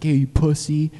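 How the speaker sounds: taunting. Retold or imitated. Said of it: "We're like".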